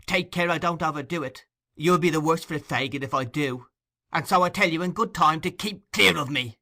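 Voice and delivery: loud, high, defiant snap; furious retort. Acted. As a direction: fling it back at him. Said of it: "passion of rage".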